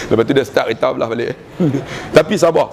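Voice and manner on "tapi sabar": ordinary talk, nothing marked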